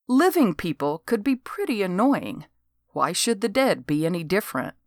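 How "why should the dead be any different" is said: ordinary delivery, nothing marked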